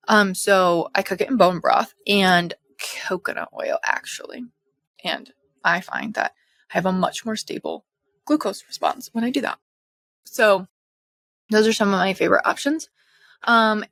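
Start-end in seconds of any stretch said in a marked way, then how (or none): none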